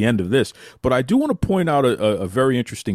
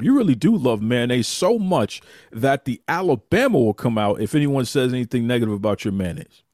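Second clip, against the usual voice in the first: ordinary talk, nothing marked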